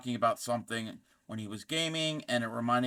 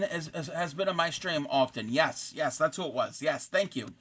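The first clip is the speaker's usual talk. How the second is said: normal talking voice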